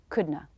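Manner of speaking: couldna as COOD-nuh; soft couldna demo; reduced couldna. Shown as a demonstration. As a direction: drop not, N then schwa. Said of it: "This is the same for couldn't have".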